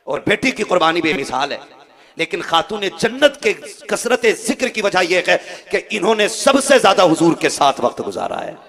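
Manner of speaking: loud, emphatic declamation; full-force preaching. Acted. Preacher explaining why Fatima is mentioned most, loud emphatic preaching. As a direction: drive each phrase home forcefully.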